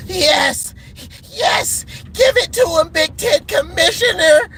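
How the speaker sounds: high, shouted mock cheer; mock fan cheer. Vlogger imitating rival fans, high shouted mock cheer. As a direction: cheer on the commissioner like a gloating fan.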